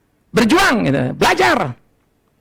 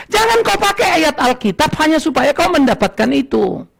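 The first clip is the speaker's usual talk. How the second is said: usual speaking voice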